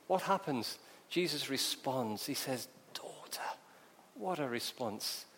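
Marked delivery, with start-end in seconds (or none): 2.9-3.4 very soft, near-whispered; tender address. Acted. Jesus responding to the healed woman, hushed tenderness. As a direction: say it hushed and gently.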